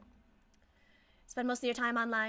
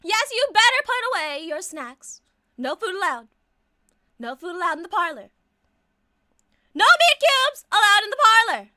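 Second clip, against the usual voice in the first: scolding; 5 semitones higher, 12 dB louder than usual. Acted. Scolding customer over food.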